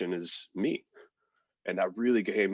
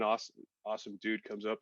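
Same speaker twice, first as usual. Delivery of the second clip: normal talking voice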